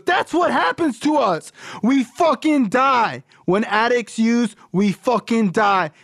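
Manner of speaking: very high-pitched, emphatic imitation; impassioned rant. Sponsor telling young caller that addicts die, impassioned rant. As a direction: push the voice way up, drive each phrase home. Sponsor rants in retold phone call.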